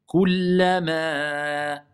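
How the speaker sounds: slow, drawn-out tajwid chant; Quran recitation. Shown as a demonstration. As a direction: recite it slowly with long prolongations. Teacher recites Quran verse. (récitation lente du Coran)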